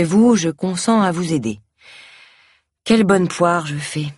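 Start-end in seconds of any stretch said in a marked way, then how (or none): none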